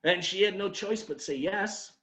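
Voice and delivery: normal talking voice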